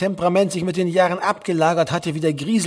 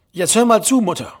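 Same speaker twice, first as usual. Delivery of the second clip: louder, higher, forceful demand for attention; counter-attack; 6 semitones higher, 5 dB louder than usual. A narrator's reading. Son retaking the argument from his mother, forceful. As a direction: launch back in firmly. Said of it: "startete er erneut seinen Gegenangriff".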